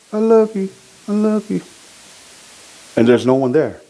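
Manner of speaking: ordinary talk, nothing marked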